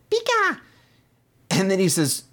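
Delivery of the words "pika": very high clipped squeak; high creature chirp; Pikachu cry. Retold or imitated. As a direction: chirp it like the cartoon mouse. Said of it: "He's like".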